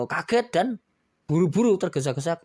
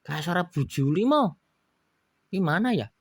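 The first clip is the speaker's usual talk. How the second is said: lightly voiced Pak Juli; 7 semitones higher, 4 dB louder than usual. Pak Juli talking to Momo.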